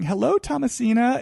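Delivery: sing-song high "Hello, Thomasina"; Kathy's greeting. Retold or imitated. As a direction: greet her with sweet fake charm. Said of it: "greets Sutton ... by saying".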